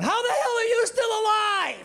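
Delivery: high, shocked yell; horrified shout. Retold or imitated. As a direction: scream it in disbelief. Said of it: "And I'm going".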